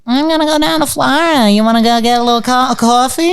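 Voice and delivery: high-pitched New York lady caricature; chatty put-on New York voice, pitched high. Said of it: "the lady who goes".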